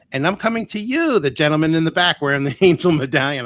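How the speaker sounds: high, loud call to the back of the room; ringing stage-show call-out; stage announcement. Retold or imitated. Medium calling out from stage.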